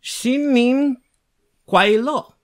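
high, loud attempt at Chinese pronunciation. Cohost tries his Chinese when asked, attempted Chinese. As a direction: have a go at Mandarin, pitched high. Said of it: "do you speak any Chinese".

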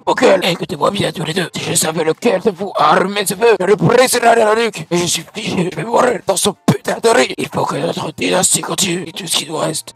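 parrain malade et menaçant, voix forcée plus haute; voix de cancéreux. Acted. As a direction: menace tes hommes d'une voix éraillée, à bout. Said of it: "avec une voix de cancéreux". (voix de vieux mafieux cancéreux, surjouée)

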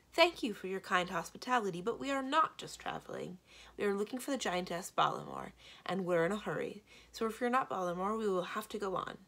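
usual speaking voice

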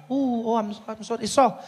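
high-pitched fake cooing; sarcastic, caricatured concern; mock sympathy. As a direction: pretend to pity the patient. Receptionist feigns sympathy.

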